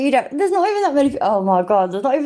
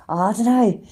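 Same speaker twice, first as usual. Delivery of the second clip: usual speaking voice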